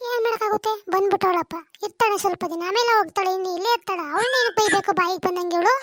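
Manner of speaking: lightly voiced nagging mother-in-law; a mild hint of an old mother scolding; mother complaining to her son about his wife.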